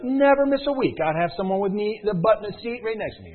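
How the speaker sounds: high, loud eager vow; mock eager recruit; eager promise. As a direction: gush it out like a convert. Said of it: "you'd be like".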